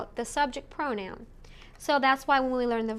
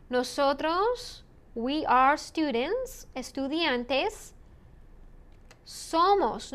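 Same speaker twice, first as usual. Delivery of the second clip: slow prompt; 2 semitones higher, slower than usual. Shown as a demonstration. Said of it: "teacher slows for prompt".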